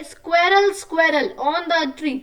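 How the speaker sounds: slow sing-song rhyme recital; reciting a rhyme. Shown as a demonstration. Teacher to young kids, slow sing-song rhyme. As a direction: read the poem to children, stretched out. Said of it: "छोटी सी बोल लिखी है".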